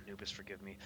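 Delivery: soft, low murmured prayer; subtle prayer. Acted. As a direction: pray it almost under your breath. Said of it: "give a subtle prayer".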